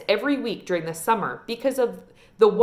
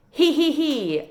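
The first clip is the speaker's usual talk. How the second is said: higher, lightly voiced cackling laugh; old woman's cackle; 7 semitones higher, slower than usual. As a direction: cackle from behind the trees. Hidden witch laughs.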